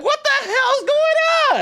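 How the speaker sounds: squealed, laughing bewilderment; shocked reaction. Retold or imitated. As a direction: retell it in mock alarm, stretching the words. Acts out shocked reaction to chat.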